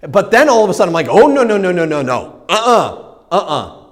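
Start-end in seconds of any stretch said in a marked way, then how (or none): 1.1-3.9 rapid-fire protest, starting high and loud; acted-out stubborn denial; emphatic refusal. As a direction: shoot the idea down, loud at first and then easing. Acting out his refusal to stop.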